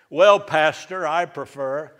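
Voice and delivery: high, loud mock complaint; whiny churchgoer. A pastor mocks a picky churchgoer with a loud whiny complaint. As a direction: whine it like a picky member.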